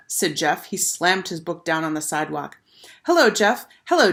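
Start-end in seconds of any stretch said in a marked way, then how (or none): none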